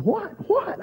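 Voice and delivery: high, sharp startled question; suddenly alarmed, pitch jumps up; startled what. Retold or imitated. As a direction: pastor startled by friend's news, high alarmed. Startled reply to friend.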